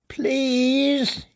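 high, feeble pleading squeak; thin weak squeak. A narrator's reading. Tormented prisoner begging the boys, thin weak squeak. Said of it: "he squeaked, his voice thin and weak".

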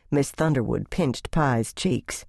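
ordinary delivery, nothing marked

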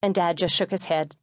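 plain reading voice

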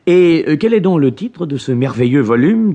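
a nephew flattering his uncle with a hammed-up question; overeager question. A narrator's reading. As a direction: ask with fake, too-keen interest. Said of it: "avec un empressement trop enthousiaste".

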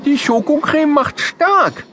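talking through a mouthful of bread; child at lunch with a full mouth, chewing while talking. Acted. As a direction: say it round a bite of chocolate sandwich. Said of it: "sagt mit vollem Mund".

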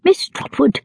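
lightly voiced imperious aunt; Miss Betsy. A narrator's reading. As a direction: announce your name like a severe old lady. Betsy introduces herself sternly.

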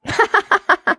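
a high, bursting laugh; burst of laughter. A narrator's reading. Charlotte bursting out laughing at Carrick's boast, high laugh. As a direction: let a big laugh explode out. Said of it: "She exploded with laughter".

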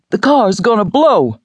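loud, high warning yell; frantic warning cry; urgent shout. Acted. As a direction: shout it over the sirens. Man yelling at car crash.

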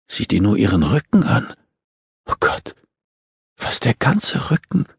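hushed horror from a woman; horrified. A narrator's reading. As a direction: react with understated shock, breath catching. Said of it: "Die Stimme der Frau klang entsetzt".

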